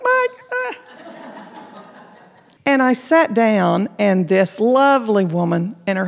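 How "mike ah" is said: high mock cry for her husband; a squeaky mock wail; mock panic. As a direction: call out for Mike in playful alarm. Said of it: "I was like".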